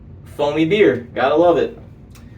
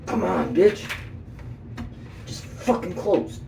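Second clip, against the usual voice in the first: exasperated, higher-pitched grumble to oneself; frustrated; 5 semitones higher, 8 dB quieter, slower than usual. Real, not acted. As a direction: plead crossly with a thing that won't budge. Struggling with something that won't close.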